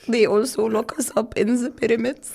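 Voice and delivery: mock comeback, laughing as she says it; laughing through it. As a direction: deliver the joke with laughter breaking in. Sarcastic retort quoted while laughing.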